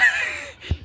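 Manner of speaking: high giggle. Real, not acted. Laughing at swivel chair.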